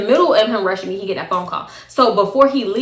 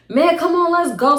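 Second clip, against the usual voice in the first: pitched up, hurrying her out; high mock of his impatience; high impatient boyfriend; 8 semitones higher than usual. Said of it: "I'm like".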